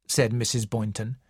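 plain reading voice

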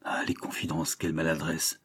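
low, hushed aside to oneself; inner thought. A narrator's reading. Julie privately regretting her confidences, hushed thought. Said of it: "pensa Julie".

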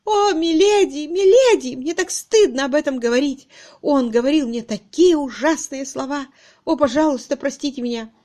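high, loud, wailing confession; distraught. A narrator's reading. Miss Twitterton falling into Harriet's arms, distraught. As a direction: pour out shame in a pleading wail. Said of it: "совсем доконало мисс Твиттертон".

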